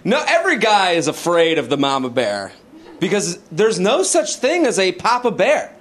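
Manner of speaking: ordinary talk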